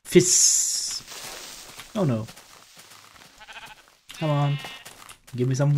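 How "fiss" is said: creeper hiss. Pretend. Creeper about to explode.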